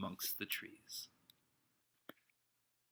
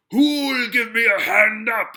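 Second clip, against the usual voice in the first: loud, high, slow roar; 11 semitones higher, 14 dB louder, slower than usual. A narrator's reading. Silver roaring to the men for help, slow and loud. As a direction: roar it at the whole crowd. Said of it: "he roared".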